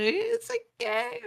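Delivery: high-pitched put-on character voice; the requested voice. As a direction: imitate him doing a silly high voice. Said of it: "Say it in the voice".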